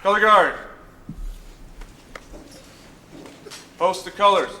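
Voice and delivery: loud barked military command; drill command. Real, not acted. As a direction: call it out across the parade ground. Calling drill commands at ceremony.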